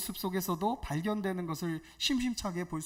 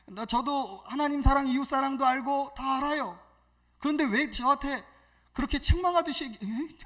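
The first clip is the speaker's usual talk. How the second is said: raised, indignant retort in imitation; imitated angry young man; 6 semitones higher, 6 dB louder than usual. Young man protesting to evangelist about being scolded, imitated anger. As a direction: act out his heated self-defence. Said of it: "화를 막 내셔".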